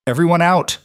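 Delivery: high, loud icy order; cold sharp command. A narrator's reading. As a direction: snap it out like ice, still polite. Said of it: "Her mother's voice was ice wrapped in courtesy".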